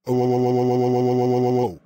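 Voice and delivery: repeated loud "oh" sobs, hammed up; fake sobbing. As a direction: blubber loudly on purpose, like a sulking kid. Fake sobbing right after "without crying.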